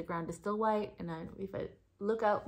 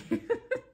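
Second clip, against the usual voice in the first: high laugh. Real, not acted. Laughing at her starstruck self.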